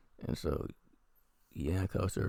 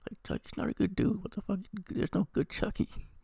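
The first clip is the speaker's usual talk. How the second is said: high-pitched incredulous protest; exasperated. Real, not acted. Exasperated rant about plot.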